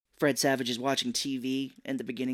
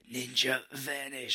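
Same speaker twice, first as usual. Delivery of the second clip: slow, breathy ninja line; hammy Turtles voice; cartoon ninja voice; slower than usual. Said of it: "I'm like".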